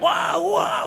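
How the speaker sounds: high wailing "waa" cries; high-pitched mock screams; imitated panicked screaming. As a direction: mimic passengers screaming in panic. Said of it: "udah pada teriak".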